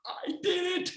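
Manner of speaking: whispered, breathless relief; hushed relief after the zipline; relieved whisper. Retold or imitated. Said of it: "and you're like".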